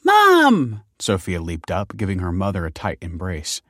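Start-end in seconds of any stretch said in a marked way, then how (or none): 0.0-0.8 put-on high, loud child's cry; delighted little girl's squeal of greeting; excited child. A narrator's reading. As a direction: call out to mum in delight.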